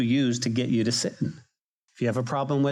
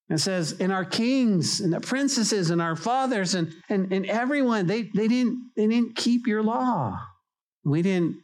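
higher, pleading confession; fervent preaching; 5 semitones higher than usual. Acted. Preacher voicing Israel's confession to God, fervent.

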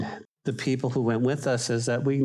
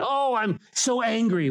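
mock self-anger; 9 semitones higher than usual. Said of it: "Why are you angry?".

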